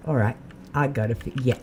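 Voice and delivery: ordinary talk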